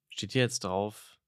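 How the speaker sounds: usual speaking voice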